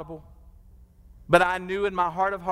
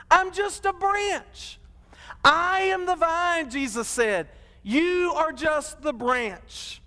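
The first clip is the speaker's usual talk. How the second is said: higher, slow, drawn-out sermon build; full-force preaching; 6 semitones higher, slower than usual. Acted. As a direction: preach it, stretching each phrase upward.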